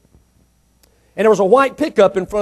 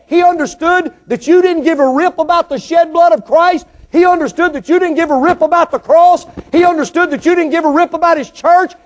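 higher and louder, hammering each line; full-force preaching; 8 semitones higher, 4 dB louder than usual. Acted. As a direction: preach it hard to the congregation.

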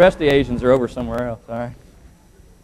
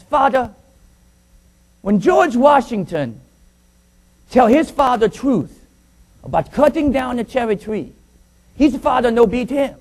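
high, slow boy in a put-on Chinese accent; mock Chinese accent; 9 semitones higher, slower than usual. Beaten boy appealing to his father, mock Chinese accent. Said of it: "looks up at his father and says".

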